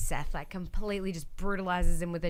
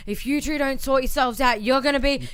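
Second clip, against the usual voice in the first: raised, loud scolding imitation; Vince McMahon; 6 semitones higher, 9 dB louder than usual. Host imitates puppet boss threatening two characters, mock scolding. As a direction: lay down a hammed-up boss's threat. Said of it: "it's a Vince McMahon".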